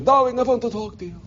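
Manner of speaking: high, raspy little European aunt; Aunt Fanny. Retold or imitated. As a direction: imitate an old European aunt, accent and rasp. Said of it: "her little, uh, European raspy voice".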